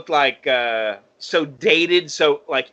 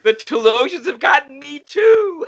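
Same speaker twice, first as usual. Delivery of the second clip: high, loud mock panic; mock alarm; 12 semitones higher, 5 dB louder than usual. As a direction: cry it out as if possessed.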